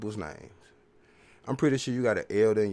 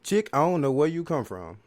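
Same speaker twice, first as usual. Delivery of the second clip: scolding; 6 semitones higher, 5 dB louder than usual. Real, not acted. Scolding the absent woman.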